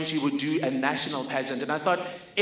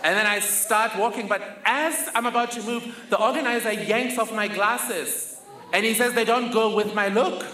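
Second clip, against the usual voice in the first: nervous excitement; 6 semitones higher than usual. Real, not acted. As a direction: tell it with rising nerves. Terrified walk onto stage.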